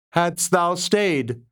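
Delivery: ordinary delivery, nothing marked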